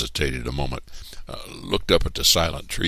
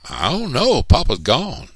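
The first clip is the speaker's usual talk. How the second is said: muttered worry; 12 semitones higher, 7 dB louder than usual. A narrator's reading. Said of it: "Stacy muttered to himself".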